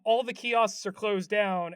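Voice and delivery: ordinary talk, nothing marked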